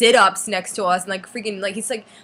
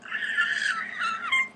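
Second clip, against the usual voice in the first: very high, shrieking fangirl squeal; giddy squeal; 5 dB quieter than usual. Retold or imitated. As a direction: squeal as if your idol just touched your hand. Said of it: "and I was like".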